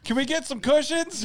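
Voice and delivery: high laughing mock request; whiny kid. Goalie asks coach for cushions, high laughing mock.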